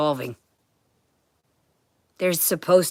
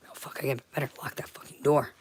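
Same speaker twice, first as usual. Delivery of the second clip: muttered aside; 3 semitones lower, 7 dB quieter than usual. Real, not acted. Sudden muttered aside to herself.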